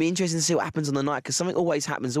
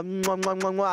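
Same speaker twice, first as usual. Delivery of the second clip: blown kisses; 7 semitones higher than usual. Acted. Blowing kisses to birthday caller.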